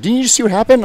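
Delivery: high, loud mock disbelief; imitated incredulous friend. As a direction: mimic a buddy demanding an answer. Friend's incredulous question, acted out.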